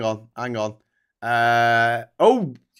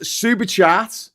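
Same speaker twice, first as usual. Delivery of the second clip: high, slow excited call; excited exclamation; 9 semitones higher, 7 dB louder, much slower than usual. Retold or imitated. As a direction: read it out like a cheer.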